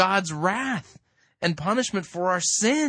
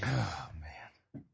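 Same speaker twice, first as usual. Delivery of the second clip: very low, whispery sigh of words; barely voiced, exasperated murmur; muttered dismay; 12 semitones lower, 12 dB quieter than usual. Real, not acted. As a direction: breathe it out in weary disbelief. Dismayed reaction to the played sermon.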